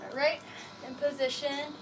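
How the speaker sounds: normal talking voice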